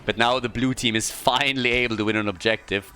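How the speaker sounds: ordinary talk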